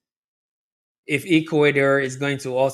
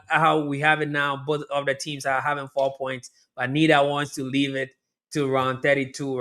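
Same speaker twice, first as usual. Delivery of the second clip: ordinary delivery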